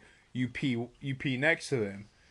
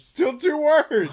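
loud high protest; 12 dB louder, slower than usual. Real, not acted. Arguing over word count.

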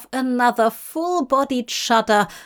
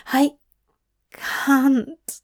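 higher, halting, upset voice; understated tearful protest; 4 semitones higher than usual. Acted. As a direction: falter on the verge of tears. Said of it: "Aziraphale sniffed".

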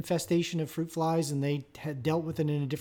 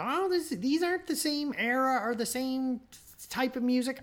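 mock complaining listener; 7 semitones higher, 3 dB quieter than usual. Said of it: "don't yell at me about".

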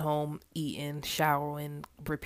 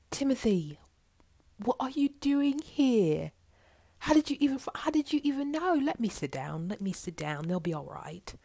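higher, shaken, half whispered; tearful Sheila; 6 semitones higher than usual. Acted. Sheila stunned to find Timothy at work, higher and shaky. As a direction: stammer it out overwhelmed.

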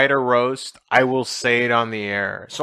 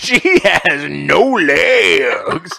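very drawn-out, high, loud club announcer; stripper DJ voice; 8 semitones higher, 8 dB louder, much slower than usual. Pretend. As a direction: hype it like a club DJ, on the edge of laughter. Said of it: "talking in my stripper D J voice".

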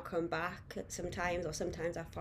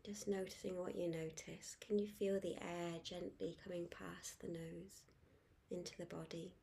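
guided meditation; 9 dB quieter than usual. Acted. Guide inviting listeners to focus on the breath, soft meditation voice. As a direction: ease them gently into calm, letting each phrase settle.

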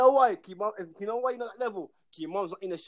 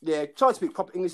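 usual speaking voice

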